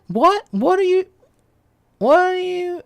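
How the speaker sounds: incredulous outburst. Retold or imitated.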